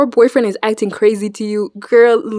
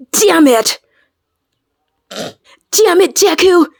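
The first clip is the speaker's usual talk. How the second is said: a hint of hissed, strained anger, higher; mock Bakugo rage; 7 semitones higher, slower than usual. Vlogger imitates Bakugo cursing at Deku, strained. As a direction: do a half-whispered tantrum at Deku. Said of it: "he's like".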